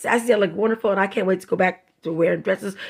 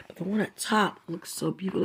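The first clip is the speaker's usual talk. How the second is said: normal talking voice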